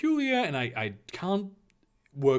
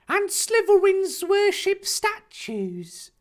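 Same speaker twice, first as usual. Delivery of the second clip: high, loud, slow Harry voice; innocent query; 8 dB louder, slower than usual. Acted. Harry asking the Reverend about Slytherins, innocently. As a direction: ask it in drawn-out, piping innocence. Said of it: "Harry queried innocently".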